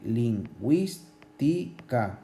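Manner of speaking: "lingüística" slowly broken as lin-güís-ti-ca; syllable by syllable. Shown as a demonstration. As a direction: give each syllable of "lingüística" its own beat. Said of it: "Aquí es una esdrújula".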